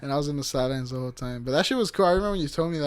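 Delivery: usual speaking voice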